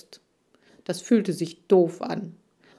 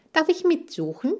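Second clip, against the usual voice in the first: eager squeak; 10 semitones higher than usual. A narrator's reading. Chan asks eagerly.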